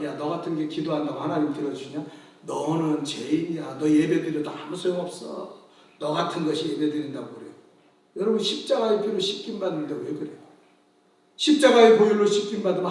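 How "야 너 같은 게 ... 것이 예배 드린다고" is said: devil's sneer. Retold or imitated. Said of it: "마귀는 우리를 정죄하는 게 일이에요".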